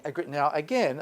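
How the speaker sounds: ordinary talk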